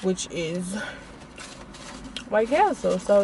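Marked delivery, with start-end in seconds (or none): none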